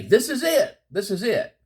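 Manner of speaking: high, excited cry of finding it; thrilled to have the proof at last; excited discovery. Retold or imitated. Someone announcing the find, mock excited. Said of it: "and to say".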